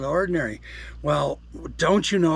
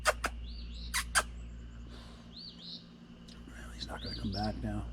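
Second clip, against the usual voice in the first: quiet disappointed whisper; 6 semitones lower, 16 dB quieter, slower than usual. Real, not acted. Squirrel has run off, whispered disappointment. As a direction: keep it hushed, a little let down.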